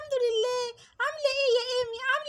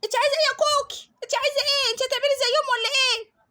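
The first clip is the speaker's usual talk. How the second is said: put-on annoyed bully girl; 2 semitones higher, 4 dB louder than usual. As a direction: push back rudely like a brat.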